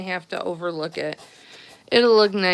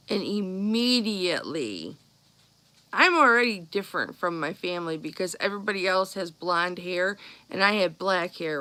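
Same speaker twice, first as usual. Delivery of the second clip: normal talking voice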